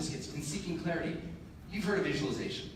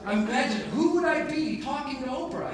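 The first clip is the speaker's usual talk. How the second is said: normal talking voice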